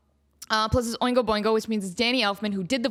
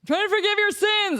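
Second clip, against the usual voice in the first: pastor; 10 semitones higher, 3 dB louder than usual. Retold or imitated. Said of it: "And the pastor's like".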